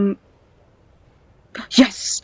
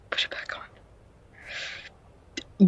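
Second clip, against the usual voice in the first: very slow, barely audible whisper; whispered plea; 11 dB quieter, much slower than usual. Pretend. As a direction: whisper the mock plea, drawn out. Hushed end of mock begging.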